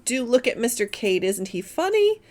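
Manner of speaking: usual speaking voice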